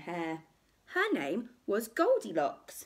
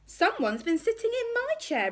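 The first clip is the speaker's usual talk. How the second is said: high-pitched indignant mother bear; affronted, high bear voice; Mummy Bear; 4 dB louder than usual. A narrator's reading. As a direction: exclaim it in a much higher mummy voice. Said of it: "rumbled Mummy Bear".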